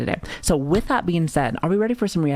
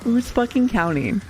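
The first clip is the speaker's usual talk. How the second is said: higher, slower mock indignation; mock outrage; 7 semitones higher, slower than usual. Real, not acted. Streamer teasing chat about counting gifts, mock outraged. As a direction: drag it out, playfully offended.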